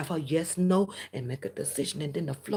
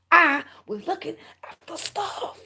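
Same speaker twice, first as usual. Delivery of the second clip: I was looking after stuff, said much higher and louder and slowed down; a louder, higher mock plea; loud, high mimicry; 11 semitones higher, 9 dB louder than usual. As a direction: belt the quoted words out high and slow. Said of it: "Remember, remember these words".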